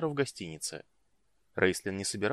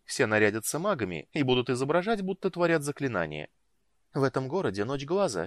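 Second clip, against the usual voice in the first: higher, animated character voice; lively, enthusiastic woman; 6 semitones higher, 4 dB louder than usual. A narrator's reading. Woman tells the mage about the festival, lively and enthusiastic. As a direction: talk up the party with relish.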